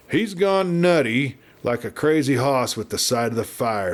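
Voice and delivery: usual speaking voice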